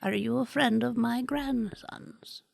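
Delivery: slow, soft whispery grandma; soft old woman. Acted. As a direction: whisper gently like an old abuela. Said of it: "she begins to talk very, very softly".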